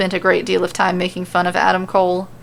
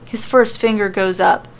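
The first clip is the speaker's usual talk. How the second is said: ordinary delivery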